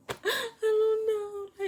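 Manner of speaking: very high, slow, sobbing denial; crying. Retold or imitated. Student sobs to the principals, very high and slow. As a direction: break into tears on it.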